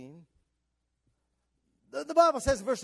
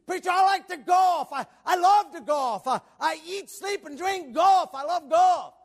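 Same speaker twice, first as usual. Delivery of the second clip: loud, high, exaggerated enthusiasm; hammed-up shouted devotion; imitated golf fanatic; 11 semitones higher, 11 dB louder than usual. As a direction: proclaim it like a devoted fan. Voices a golf-loving church member.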